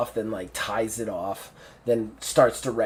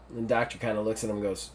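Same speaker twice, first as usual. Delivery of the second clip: normal talking voice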